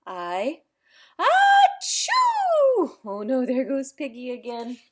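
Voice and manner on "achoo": loud, high explosive achoo; huge sneeze. Acted. Elephant sneezing on his friend, huge achoo. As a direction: let the sneeze blast out.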